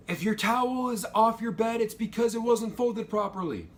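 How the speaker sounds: high, loud barked warning; drill instructor yell. Retold or imitated. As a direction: shout it down the hall like an instructor. MTIs yelling in the halls.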